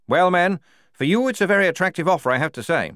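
a prim lieutenant rallying his men; lieutenant Blouse. Acted. Lieutenant Blouse addresses his squad.

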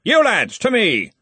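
high, loud call across the hall; shouting. A narrator's reading. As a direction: call the lads over loudly. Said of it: "he shouted".

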